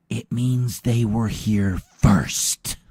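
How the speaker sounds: It means they were here first, slow, loud, they stressed; over enunciating. Shown as a demonstration. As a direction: spell it out slowly, punching they. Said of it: "let me say it slower".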